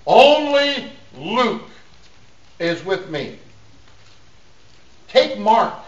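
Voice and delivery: high, deliberate preacher's reading; emphatic scripture reading. Acted. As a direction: declaim the verse to the congregation. Declaims scripture to congregation.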